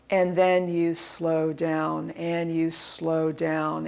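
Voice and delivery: low, dragging half-speed delivery; slowing down. Shown as a demonstration. Coach showing uneven cadence, slowed to a crawl. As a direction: stretch every word, winding right down.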